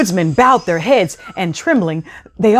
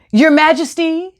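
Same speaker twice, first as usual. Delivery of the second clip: high, raised announcing voice; 8 semitones higher than usual. Acted. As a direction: announce it grandly. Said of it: "the cat announced".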